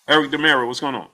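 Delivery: ordinary talk, nothing marked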